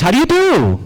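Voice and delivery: very high, insistent repeated greeting; indignant storybook rabbit, voice shooting up; upset rabbit. Acted. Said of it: "this really upsets Briar Rabbit".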